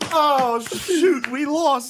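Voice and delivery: high, laughing mock disappointment; hammed-up cheering squad letdown; mock fan dismay. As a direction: play a crushed supporter in a squeaky-high voice. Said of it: "actively cheering for you".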